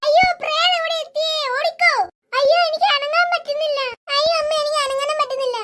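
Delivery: family frozen by the ghost, crying out in terror; terrified cries. Acted. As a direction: cry out in fear, frozen.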